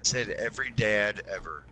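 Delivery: dry aside. Real, not acted. Dry joke under the chatter.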